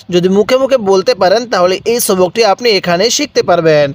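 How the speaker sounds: ordinary talk, nothing marked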